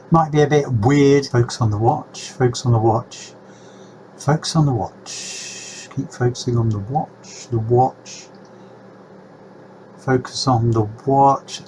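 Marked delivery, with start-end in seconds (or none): 1.3-11.6 softer repeated hypnotic command; mock hypnotist. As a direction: lure them into staring at the watch.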